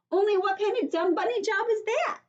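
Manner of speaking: put-on high, loud little girl yelling for help; Junie B. yelling. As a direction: cry for help like a panicked kid. Junie B. complains to Daddy.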